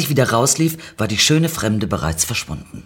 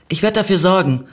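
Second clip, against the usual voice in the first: higher-pitched, mildly self-assured; a bit more confident; 8 semitones higher, 4 dB louder than usual. A narrator's reading. Narrator flirts back with the stranger, mildly confident. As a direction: promise it with a touch more confidence. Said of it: "mit ein bisschen mehr Selbstsicherheit".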